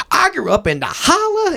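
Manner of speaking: very high mock southern twang; thick southern accent. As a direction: imitate a woman's thick Appalachian southern accent. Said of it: "it was way more than Sarah".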